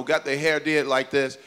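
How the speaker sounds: ordinary talk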